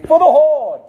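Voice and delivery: high, loud rallying shout; yelled battle cry. Pretend. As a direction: yell it like you're leading a charge. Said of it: "want you all to yell".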